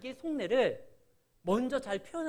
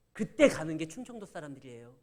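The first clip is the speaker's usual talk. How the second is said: normal talking voice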